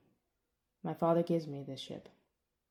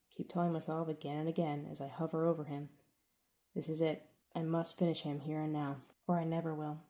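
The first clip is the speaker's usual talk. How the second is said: plain reading voice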